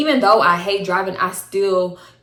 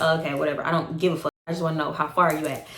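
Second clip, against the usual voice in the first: quiet fed-up retort; 5 semitones lower, 6 dB quieter, faster than usual. Retold or imitated. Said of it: "So I'm like".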